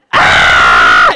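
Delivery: loud sustained scream of exasperation; exasperated scream. Retold or imitated. Parent barely settled on the beach, exasperated scream. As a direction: burst out with a full-throated "Ah!".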